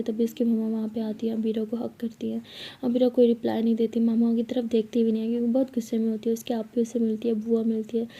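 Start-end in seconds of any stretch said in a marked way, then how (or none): none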